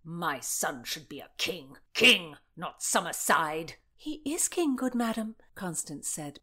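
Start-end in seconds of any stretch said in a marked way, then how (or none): 0.0-3.8 indignant, heavy outburst in character; angry Countess. A narrator's reading. Countess raging about her son's standing, angry. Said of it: "baffled at the direction of this anger". 4.0-5.4 high-voiced, puzzled protest; baffled. A narrator's reading. Constance to the raging Countess, baffled. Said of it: "baffled at the direction of this anger".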